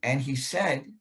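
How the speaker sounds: normal talking voice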